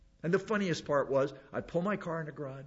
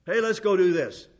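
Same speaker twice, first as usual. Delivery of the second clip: loud, higher burst of sudden eagerness; impulsive enthusiasm; 4 semitones higher, 9 dB louder than usual. Retold or imitated. Preacher acts out Peter's sudden idea, impulsively eager. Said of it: "Idea pop in his head".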